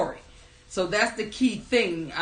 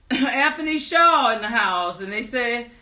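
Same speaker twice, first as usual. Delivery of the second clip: usual speaking voice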